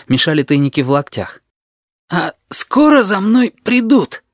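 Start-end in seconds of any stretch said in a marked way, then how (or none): 2.1-4.2 slow, high, laboured question; drawn-out, high-pitched plea; strained and suffering. A narrator's reading. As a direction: ask it straining under a crushing load. Narrator strains under the heavy jacket.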